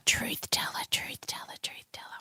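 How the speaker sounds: fading whispered echo; self-echo. Shown as a demonstration. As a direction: whisper it fainter each time like an echo. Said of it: "That definitely needed a self-echo".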